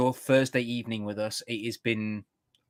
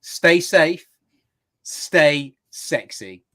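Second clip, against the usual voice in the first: sign-off catchphrase; 5 semitones higher, 5 dB louder, much slower than usual. Acted. Signature show sign-off.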